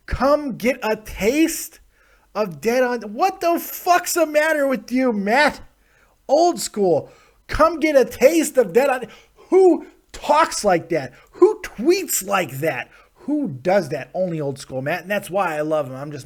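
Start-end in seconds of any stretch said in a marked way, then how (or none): 0.1-3.0 higher, slower, mocking the tweet; high mock read. Shown as a demonstration. Host mocking a fan's tweet, high slow mock. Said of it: "That, that works". 3.1-14.1 incredulous rant. Real, not acted. Ranting at fan's weird tweet.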